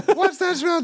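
very high laughing cheer shout; cheerleader yell. Pretend. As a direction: scream it at full cheer. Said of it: "they fucking yell so loud".

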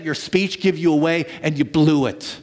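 usual speaking voice

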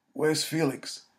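croaked, weak hoarse question; croaking. A narrator's reading. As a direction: croak it out through a dry throat. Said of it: "Joseph croaked out".

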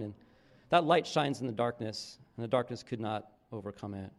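low, measured reading of John; scripture reading. Acted. Reads John one aloud.

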